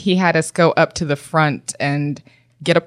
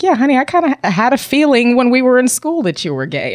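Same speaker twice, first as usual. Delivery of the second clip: high, sassy knowing voice; sassy honey; 7 semitones higher than usual. Retold or imitated. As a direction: tease her knowingly, high. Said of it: "And I was like".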